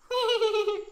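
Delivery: put-on girlish giggle, high; high falsetto giggle.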